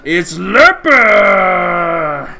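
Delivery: high, loud ring announcer, drawing out the big finish; arena taskmaster. Acted. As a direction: whip up the crowd as the arena announcer. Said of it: "the taskmaster".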